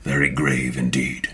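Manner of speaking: low, musing character voice; low, hesitant sage with thoughtful hums; grave Maka. Acted. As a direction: ponder solemnly in a low register. Plays Maka, grave figure.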